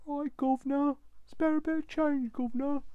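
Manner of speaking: high, loud Cockney street urchin begging. Pretend. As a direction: beg like an Oliver Twist orphan in Cockney. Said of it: "Like a production of Oliver".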